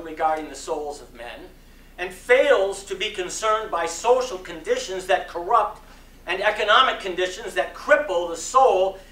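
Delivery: measured preaching cadence with rising emphasis; sermon reading. Acted. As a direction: deliver it from the pulpit, building conviction. Reads MLK sermon aloud.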